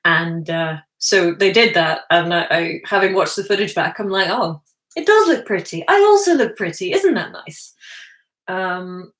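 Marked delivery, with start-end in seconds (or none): 4.3-7.7 a lightly voiced, higher self-pleased coo; mock preening. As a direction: coo over yourself like a vain star. Pleased by own looks in footage.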